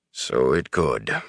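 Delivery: low, slow, unhurried agreement; low and slow, refusing to argue; laconic reply. A narrator's reading. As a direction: concede it in a deep, drawn-out voice. Tom repeats his unbothered answer.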